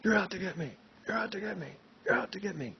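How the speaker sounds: high, loud, frantic scream, repeated; screaming Nixon. Retold or imitated. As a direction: imitate a paranoid screamed accusation. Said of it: "Nixon was screaming at me".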